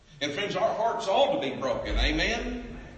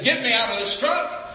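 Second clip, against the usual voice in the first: high, loud desperate cry; retold scream; 8 semitones higher, 7 dB louder than usual. Preacher voicing the burning trucker's plea, high desperate shout. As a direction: cry out as the trapped driver. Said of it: "And he began to scream".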